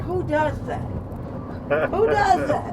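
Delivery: high, disbelieving exclaim; incredulous. Retold or imitated. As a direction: ask it in shocked disbelief. Incredulous retold reaction, rising pitch.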